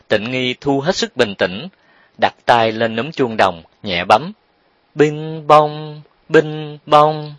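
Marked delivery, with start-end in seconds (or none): 4.9-7.3 high, level sung ding-dong; melodious doorbell chime. A narrator's reading. Narrator voices the brass doorbell being pressed, ringing chime. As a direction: imitate a ringing bell on held notes. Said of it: "Tiếng chuông vang thánh thót".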